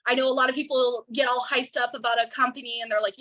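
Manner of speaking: ordinary talk, nothing marked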